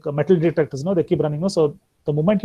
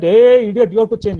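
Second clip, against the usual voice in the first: a loud, higher, scornful rebuke; scolding doctor; 5 semitones higher, 7 dB louder than usual. Shown as a demonstration. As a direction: bark it at the patient like a bossy doctor. Doctor scolding patient, wrong way.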